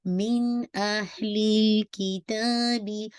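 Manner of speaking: slow tajweed chant with मिन's noon fully sounded; Quran recitation. Shown as a demonstration. Teacher demonstrates the correct noon sound, slow recitation. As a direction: recite slowly, sounding every letter of मिन अहलिल. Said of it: "not मिन sound complete कीजिएगा".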